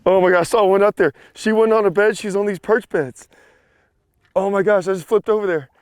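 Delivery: can't contain the excitement; real excitement after the catch; excited chatter. Real, not acted. Describing the catch, still thrilled.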